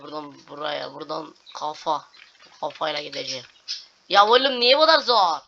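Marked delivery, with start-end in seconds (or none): 4.1-5.4 high, loud exasperated shout; frustrated yell. Real, not acted. Gamer shouting at a level that is too hard.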